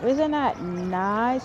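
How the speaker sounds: talking while chewing gum; words around a wad of gum. Real, not acted. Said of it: "Sorry, I'm chewing gum".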